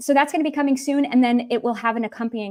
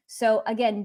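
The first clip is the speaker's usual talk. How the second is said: ordinary talk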